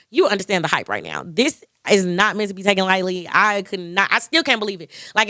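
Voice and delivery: ordinary delivery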